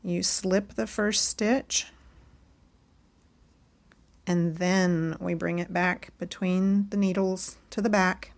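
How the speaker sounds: ordinary talk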